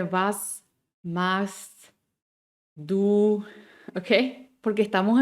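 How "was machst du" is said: simple German question melody; plain question. Shown as a demonstration. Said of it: "cuando queremos empezar, simplemente".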